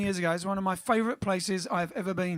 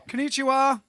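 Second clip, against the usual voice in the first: higher, louder "Konnichiwa"; cheery Japanese greeting; 5 semitones higher, 6 dB louder than usual. Acted. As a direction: greet them again with gusto.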